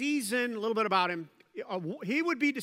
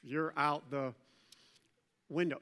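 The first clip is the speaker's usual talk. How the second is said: casual servants; 6 semitones lower than usual. Retold or imitated. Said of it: "But they're like".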